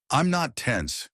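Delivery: sharp defensive retort; snapping. A narrator's reading. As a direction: snap it back at him. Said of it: "she snapped".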